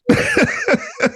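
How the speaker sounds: sudden high-pitched giggle; high burst of laughter. Real, not acted. Goalie laughing about a teammate's hard shot, high giggle. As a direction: break into a high laugh at yourself. Laughing at own cocky story.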